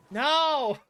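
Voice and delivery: sharp, loud "No!" up high; a burst of real protest. Real, not acted. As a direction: shout it down, half laughing. Protesting a chat joke.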